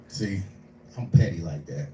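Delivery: usual speaking voice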